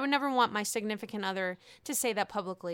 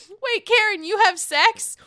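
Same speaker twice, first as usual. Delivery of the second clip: higher, louder incredulous question; mock shocked Wendy; 10 semitones higher, 9 dB louder than usual. Vlogger imitating Doctor Wendy asking Karen, higher and louder. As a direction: gasp it out in disbelief. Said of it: "she says".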